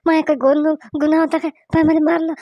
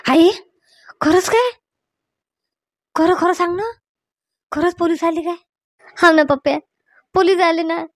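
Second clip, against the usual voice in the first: higher, tearful pleading; crying in panic; 4 semitones higher than usual. Acted. Frightened Pappu begs mother not to tell police, crying. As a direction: beg through tears not to be given away. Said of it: "रडू नका तुम्ही".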